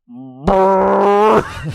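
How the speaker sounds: long, loud, high raspberry; loud comic fart sound; imitated fart noise. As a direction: blow a big drawn-out raspberry. Imitates mate breaking wind.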